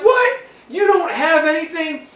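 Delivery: very high, loud mock outrage; shocked disbelief. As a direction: squawk it in hammed-up disbelief. Engineers learn nothing exists.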